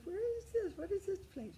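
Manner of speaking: puzzled surprise. Retold or imitated.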